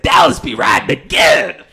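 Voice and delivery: loud whooping shout; hype yell. Retold or imitated. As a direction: bellow it like a pumped-up showman. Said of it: "I said".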